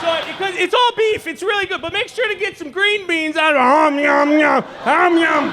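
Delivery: high, hearty voice smacking om yum; eccentric old dad. Retold or imitated. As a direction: play the dad happily eating from the dog bowl. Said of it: "he's like".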